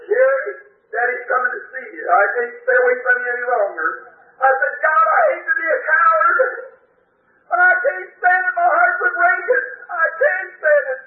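high, tearful breaking cry; high, sobbing cry of loss; grief-stricken plea. Retold or imitated. Retells grief at daughter's grave.

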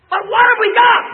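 high, loud preacher's challenge; fiery preaching. Real, not acted. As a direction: hurl the question at the congregation. Preaching at full force.

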